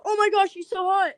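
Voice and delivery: high, swooning mock gush; teasing gush about a guy in the next car. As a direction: gush over the cute guy, a bit hammed. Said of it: "and I go".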